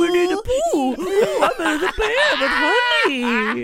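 laughing, high, loud bully jeer, slow at the end; mock taunt. [name] playing Jackie mocking her husband's taste, laughing mock shout. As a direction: sneer it out while laughing. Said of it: "you just be like".

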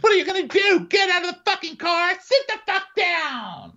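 very high screamed orders; mock furious shouting. Host mimics Jen blocking Lisa, hammed-up furious shouting. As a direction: shriek it, stretching the last command.

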